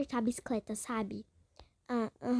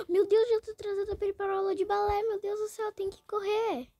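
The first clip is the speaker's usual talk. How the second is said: panicked rush; 6 semitones higher, 4 dB louder than usual. Pretend.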